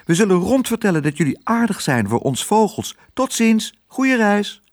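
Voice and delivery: high, chattering bird voices; twittering birds. A narrator's reading. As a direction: pipe out a chirpy farewell. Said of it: "kwetterend goeiedag te zeggen". (hoog kwetterende vogels)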